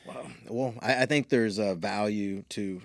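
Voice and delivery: ordinary talk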